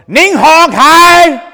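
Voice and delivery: high, loud, drawn-out mock yell in Chinese; imitating translator yelling. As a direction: bellow it like a startling interpreter. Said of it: "a Chinese man, goes".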